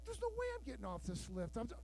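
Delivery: ordinary delivery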